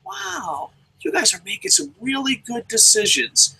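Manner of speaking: high, gushing fake-sweet flattery; butter him up in a sugary high voice; fake-nice gushing. Fan buttering up a Google employee, sugary and gushing. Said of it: "I'm like".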